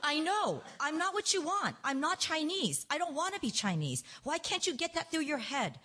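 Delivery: high-pitched, insistent protest; angry outburst. A narrator's reading. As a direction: read a daughter's exasperated outburst, pitched high. Daughter's furious outburst at mother, read.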